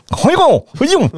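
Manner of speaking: high-pitched hammed-up Japanese imitation; mock Japanese. Doctor asked to say thanks in Japanese, hammed-up Japanese accent. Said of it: "en japonais".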